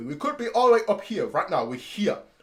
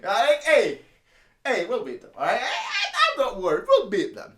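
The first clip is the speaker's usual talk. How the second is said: laughing bravado; 3 semitones higher, 4 dB louder than usual. Acted. Laughing mock confidence.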